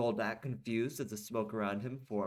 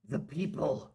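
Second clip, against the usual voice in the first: mistaken voice, less high than Goldak; wrong character voice; 5 semitones higher than usual. Shown as a demonstration. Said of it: "wrong voice, I'm sorry".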